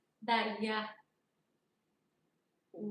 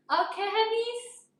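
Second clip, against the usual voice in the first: singsong endearment; 11 semitones higher, 8 dB louder, slower than usual. Real, not acted. Affectionate address to viewers.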